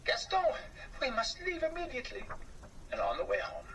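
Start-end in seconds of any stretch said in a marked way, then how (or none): 0.1-2.2 higher, hurried mouse voice; small mouse in a hurry to escape; alarmed little mouse. Acted. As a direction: urge your friend to flee, fast. Shocked mouse urging friend.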